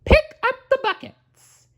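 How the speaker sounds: high cartoon mouse voice giving an order; Mickey commanding. Mickey ordering the broom in his high mouse voice. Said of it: "what Mickey said".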